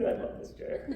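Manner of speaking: ordinary delivery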